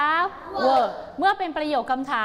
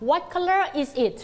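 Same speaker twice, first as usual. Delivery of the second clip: slow model question; 5 semitones higher, much slower than usual. Shown as a demonstration. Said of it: "ฟังคำถามก่อน".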